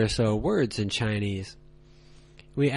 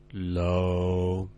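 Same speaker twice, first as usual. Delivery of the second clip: "low," held deep, lower and flat; low level tone; 6 semitones lower, 4 dB quieter than usual. Shown as a demonstration. Teacher showing learners the third tone, low and flat. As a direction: drop "low," to the bottom and hold it. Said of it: "Read only the tones and inflections".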